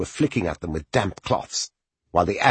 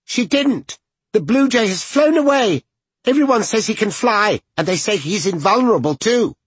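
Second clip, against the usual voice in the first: put-on high, loud defiant little boy; defiant child voice; 6 dB louder than usual. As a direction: defy him like a proud child.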